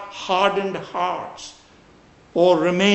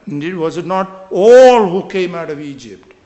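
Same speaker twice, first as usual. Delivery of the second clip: ALL stretched out and much louder; stress on ALL; 9 dB louder than usual. Shown as a demonstration. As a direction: swell hard on the word all. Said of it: "I stressed the word all".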